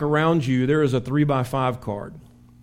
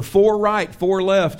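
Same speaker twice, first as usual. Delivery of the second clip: yelled warning; 5 semitones higher, 5 dB louder, slower than usual. Shown as a demonstration. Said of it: "You yell it".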